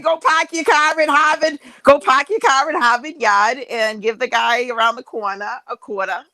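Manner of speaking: dropped-r Boston "pahk your cah"; Boston accent demo. Shown as a demonstration. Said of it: "No, you just say".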